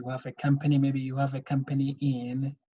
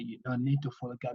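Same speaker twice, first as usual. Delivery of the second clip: a quick, softer echo of "don't need to follow gap"; quiet, faster restatement of the rule; softer repeat; 2 semitones lower, 6 dB quieter, faster than usual. Shown as a demonstration.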